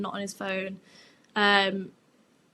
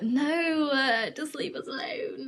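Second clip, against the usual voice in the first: higher, drawn-out mock indignation; whiny protest; 3 semitones higher, slower than usual. Both answer the friend's nosy question, whiny protest. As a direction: whine it, brushing off the teasing.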